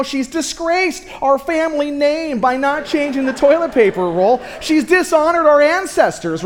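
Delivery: loud, high mock-outraged dad; mock riot act. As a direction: overblown scolding, played for laughs. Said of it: "start reading her the riot act".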